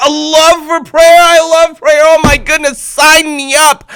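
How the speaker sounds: high, loud gushing joy; thrilled. Retold or imitated. Amin hearing about a website on prayer, loud thrilled joy. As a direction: burst with eager delight.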